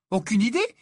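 put-on cheerful, high girl's voice; delighted. A narrator's reading. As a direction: say it gleefully, voice high. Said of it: "d'un ton réjoui".

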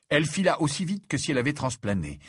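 plain reading voice

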